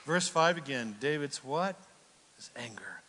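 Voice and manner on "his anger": hushed, softer and lower; whisper. Acted. Preacher hushing the congregation, whispered. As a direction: drop to a whisper. Sudden hush after shouting.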